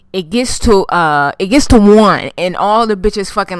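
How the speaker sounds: ordinary talk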